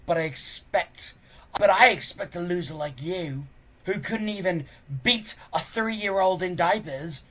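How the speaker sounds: loud, high taunting bully voice; sneering bully. Acted. Bully cousin taunting Hiccup, loud and sneering. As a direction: jeer at the loser cousin.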